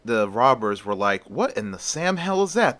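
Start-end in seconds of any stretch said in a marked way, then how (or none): 1.3-2.8 high-pitched baffled question; bewildered robber, pitched well up; incredulous. Acted. Robbers baffled by strange hero.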